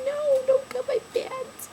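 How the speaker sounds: very high, slow mock whine; sad pleading. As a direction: beg tearfully in a tiny squeal. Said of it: "I was so sad when they told me".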